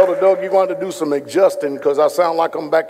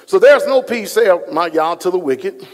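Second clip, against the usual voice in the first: scripture proclaimed; 2 semitones higher, 4 dB louder than usual. Acted. Preacher reading Isaiah to the congregation, proclaimed. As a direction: proclaim the verse.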